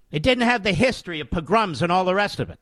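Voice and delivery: normal talking voice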